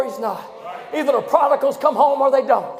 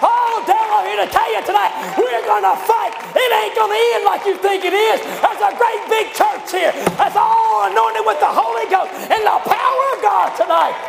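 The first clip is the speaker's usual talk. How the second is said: rising, shouted preacher's build to the fight; fiery preaching; 7 semitones higher than usual. Acted. Preacher rousing the church to fight, shouting.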